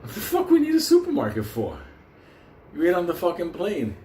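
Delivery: demanding why they need a supermarket; incredulous yell. Retold or imitated. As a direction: shriek at him in disbelief. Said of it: "arguing with friend".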